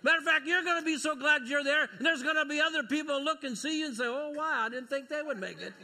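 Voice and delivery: loud, high, ringing preacher's voice; preaching at full force. Acted. Preacher calling out to the church about heaven, at full force. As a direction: preach it out to the whole congregation.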